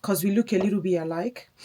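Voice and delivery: usual speaking voice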